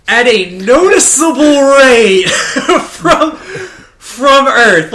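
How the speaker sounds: laughing incredulous outburst; laughing disbelief. Real, not acted. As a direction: crack up at the absurd plot.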